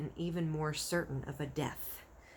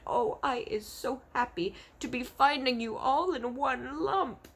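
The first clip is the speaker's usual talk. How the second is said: relieved BFG; 7 semitones higher, 3 dB louder than usual. Acted.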